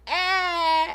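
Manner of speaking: high wailing cry imitating the baby; baby's wail. Mom imitating her daughter's wail, high pitched. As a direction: mimic her crying. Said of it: "And she's just like".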